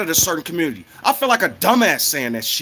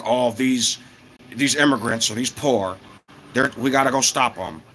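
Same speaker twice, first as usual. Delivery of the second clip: mock rich snob; 7 semitones lower than usual. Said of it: "and they'll be like".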